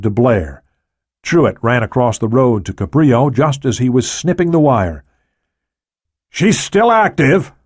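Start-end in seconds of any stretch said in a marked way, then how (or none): 6.3-7.5 high, slow urgent shout. A narrator's reading. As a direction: shout it out in alarm. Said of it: "Cabrillo shouted".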